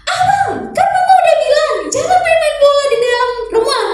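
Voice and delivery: a mum losing her temper at her son; scolding mother. Retold or imitated. Mother scolds son for playing ball indoors, high loud. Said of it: "kalau biasanya mungkin ya".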